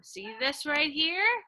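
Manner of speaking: normal talking voice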